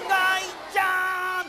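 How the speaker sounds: slow, loud, high call of the name; drawn-out shout of ガイちゃん. Shown as a demonstration. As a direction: call out so loud it blows the roof off. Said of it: "バーンと飛んじゃうぐらい大きい声で".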